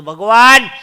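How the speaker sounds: loud cry of ભગવાન; calling out. Retold or imitated. Man shouting to God on his mobile. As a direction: yell ભગવાન into the phone.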